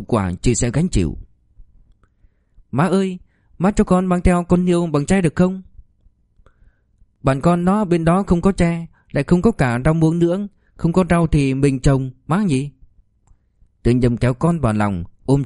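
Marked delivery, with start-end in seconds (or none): 2.7-12.7 put-on high, small child's voice; pleading little boy. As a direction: ask mom like a six-year-old. Narrator voices young son.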